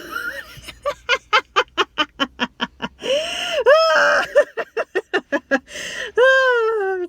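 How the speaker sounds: a long high laughing shriek that cracks. Real, not acted. Reacting to shocking news from her cousin, shrieking laugh. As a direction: let out a wild laughing scream. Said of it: "I got this news and I screamed".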